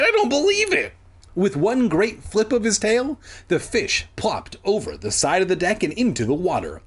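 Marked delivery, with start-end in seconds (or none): 0.0-0.9 very high cry of disbelief; shocked, squeaky-high exclamation; cried out. Acted. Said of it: "Sheena cried".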